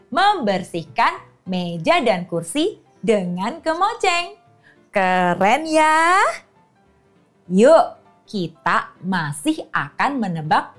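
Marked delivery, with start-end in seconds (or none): none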